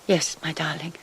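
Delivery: soft tender whisper. Acted. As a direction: breathe it tenderly. Tender farewell whispered to Charlie.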